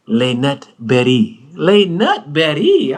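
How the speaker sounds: "Les Nut Berry" slow and savoured, like a posh menu word; imitating his fancy pronunciation. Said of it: "But the way he said".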